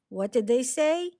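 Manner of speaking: plain reading voice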